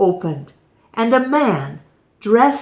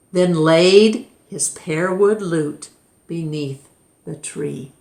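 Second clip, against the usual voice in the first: plain reading voice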